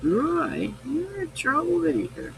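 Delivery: high sing-song teasing of a chat member; sing-song tease. Pretend. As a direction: coo it at him like scolding a naughty kid. Repeats the tease to Roy.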